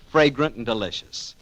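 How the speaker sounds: normal talking voice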